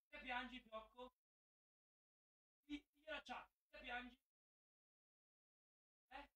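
tender, almost silent sing-song. Real, not acted. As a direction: murmur gently to a crying puppy. Talking to his crying dog.

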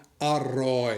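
"อร่อย" said slowly with a full "a-" and a clear r; standard "อร่อย". Shown as a demonstration. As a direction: spell out "อร่อย" slowly, as it is written. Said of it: "ถ้าออกเสียงถูก ออกเสียงเหมือนคนเขียน". (ออกเสียง "อร่อย" ช้าๆ ตามตัวเขียน)

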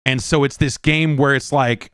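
ordinary delivery

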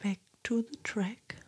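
soft whisper close to the mic; ASMR whisper. Acted. Whispering her makeup steps to ASMR viewers, soft whisper. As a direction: whisper gently, as if sitting right beside them.

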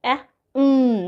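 short "অ্যা", pause, then held hum "উম"; sounding out letters. Shown as a demonstration. Teacher showing two letter blending, sounds kept apart. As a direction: say "অ্যা" and "উম" as separate phonic sounds. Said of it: "দুটো মিলে কেমন হচ্ছে".

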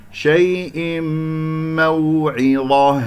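شيء موعظة slowly chanted, the tanween melting into a long م; nasal merging. Shown as a demonstration. Teacher modelling idgham with ghunnah, slow held hum into موعظة. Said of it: "أكسر ثم أدخل في الإدغام".

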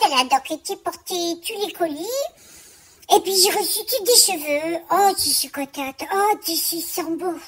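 a quirky small-business lady complaining to a friend; Germaine character voice. Acted. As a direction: play a fussy chatty seamstress. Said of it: "c'est toute Germaine".

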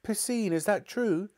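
very high questioning voice; high, disbelieving question; dismayed mother. Acted. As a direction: ask your child for the truth. Mother questions her son.

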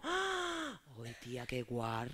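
hushed, hammed-up gossip; mock scandalized gossip. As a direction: whisper the scandal. Mock gossip girls and lads. (compañeros del instituto cuchicheando escandalizados)

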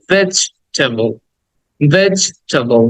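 ভেজিটেবল as "vej", stop, "tebol"; pause inside word. Shown as a demonstration. Teacher tells students to stop mid-word, split pronunciation. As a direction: halt after the first syllable of ভেজিটেবল. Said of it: "dot দাও, থামো".

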